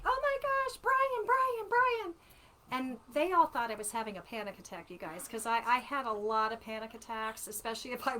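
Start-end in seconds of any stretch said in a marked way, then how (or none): 0.1-2.1 high, loud startled cry of a name; retold scream. Mother spotting the ladybug, screaming her son's name. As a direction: shriek his name, shocked and overjoyed. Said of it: "I screamed".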